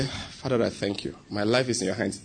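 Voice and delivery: low, hushed prayer of thanks; quiet prayer. Acted. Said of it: "you bow your head and say".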